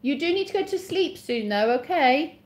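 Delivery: projected parental call; calling out instructions. Real, not acted. Telling child upstairs to sleep.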